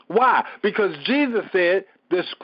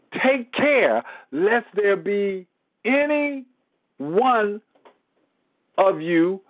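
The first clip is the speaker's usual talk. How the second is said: slow, deliberate sermon reading; weighty reading with long pauses; emphatic scripture reading; 3 semitones higher, slower than usual. Acted. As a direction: read the verse out phrase by phrase. Reads scripture aloud slowly, emphatically.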